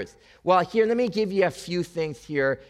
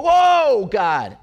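高く大きく驚いて; 心から圧倒されたように叫ぶ; amazed whoa; 5 semitones higher, 10 dB louder than usual. Retold or imitated. Amazed reaction to God's gifts.

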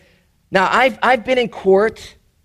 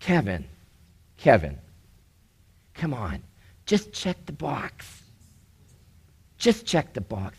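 hushed, falling, persuasive murmur; coaxing; 5 semitones lower, 8 dB quieter than usual. Retold or imitated. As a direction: quietly coax them into giving in, voice dropped low. Official coaxing pastor to compromise.

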